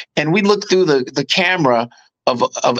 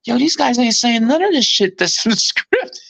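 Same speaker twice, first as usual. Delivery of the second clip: high, incredulous imitation breaking into a laugh; mock disbelief, pitched up high; retold astonishment; 9 semitones higher than usual. Co-stars notice the script changes, high amazed imitation.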